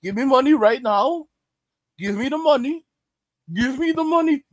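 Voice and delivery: high mock demanding. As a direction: mimic an entitled Gen Z kid. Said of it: "That's Gen Z".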